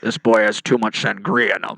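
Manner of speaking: low, loud put-on officer; mock cop voice. Pretend cop reporting on the kid, low loud voice. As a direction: announce it with booming mock authority.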